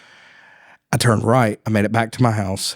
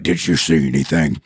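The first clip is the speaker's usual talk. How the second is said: lower, steady cop question; lightly voiced tough cop; deep cop voice; 5 semitones lower than usual. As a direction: ask it like a big stern officer. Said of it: "And he's just like".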